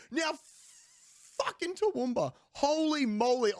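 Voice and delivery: a touch of mock outrage at a menu name; exasperated disbelief. Real, not acted. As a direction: blurt it out, stunned. Exasperated reaction to menu item.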